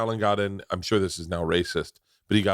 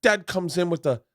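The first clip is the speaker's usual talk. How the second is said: high, hammed-up cockney threat; over-the-top cockney gangster boast; British gangster accent; 8 semitones higher than usual. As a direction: tell the gangster tale in a put-on London accent. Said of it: "can't do the accent".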